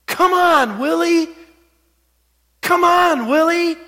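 high, loud imitation of a manager's yell; shrill yelling. Manager riding his rookie from third base, shrill mock yell. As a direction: call it out high and shrill, mimicking the coach. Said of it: "just with this shrill voice, he kept just yelling at me".

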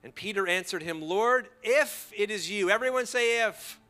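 loud, high, incredulous preacher; full-force preaching. Real, not acted. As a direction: drive the point home at the top of the voice.

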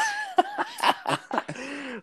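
shrill high-pitched laugh; very high giggle. Real, not acted. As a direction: break into a squealing laugh. Laughing at the reply.

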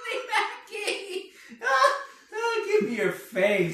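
very high gleeful cry; excited. Pretend. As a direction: squeal it with glee. Excited punchline voice.

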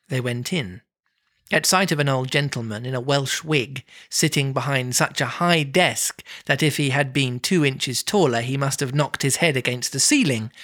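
usual speaking voice

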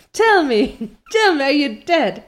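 hammed-up frantic pleading, high and loud; Diana, shrieking. A narrator's reading. Diana to motionless Anne, acted shriek. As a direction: cry out as if begging for an answer. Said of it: "shrieked Diana".

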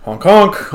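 loud mock horn honk. Imitating truck horns, louder.

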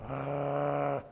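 a low guttural growl like a guarding dog; imitated dog growl. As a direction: growl like a loyal dog protecting its owner. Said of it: "learn how to become like a dog".

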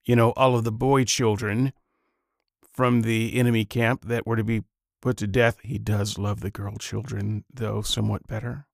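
ordinary delivery

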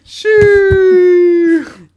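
high, very loud long shoo; shrieked shoo; mock shoo scream. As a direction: screech to chase someone away. Shooing bit.

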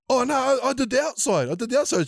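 put-on a kid making excuses; defensive kid. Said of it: "And you're like".

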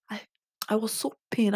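usual speaking voice

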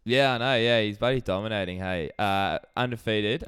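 usual speaking voice

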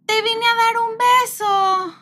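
put-on high, slow, sweet child voice; a daughter comes to give her mother a kiss; little daughter's voice. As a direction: stretch it out like a small girl being cute. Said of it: "Lore luego llega y así de".

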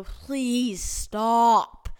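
loud, drawn-out retold plea; exasperated plea. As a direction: raise your voice and beg them to quit. Retold plea to teasing classmates.

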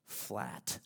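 usual speaking voice

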